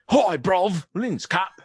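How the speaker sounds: high, loud hammed-up Cockney accent; mock Cockney accent. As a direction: play a cheeky Cockney bloke, overdone. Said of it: "I'm from Cockney".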